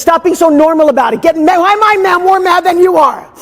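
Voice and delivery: high, loud, impatient push; fired-up urging. Real, not acted. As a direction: goad them into anger. Coach pushing client to get mad.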